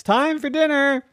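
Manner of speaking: high singsong dinner call. A narrator's reading. Daddy calls his daughter down to dinner, calling out. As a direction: call the family to the table.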